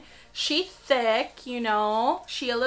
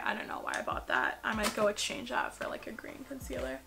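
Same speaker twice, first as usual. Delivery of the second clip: whisper; 8 dB quieter than usual. Real, not acted. Unboxing aside muttered to self.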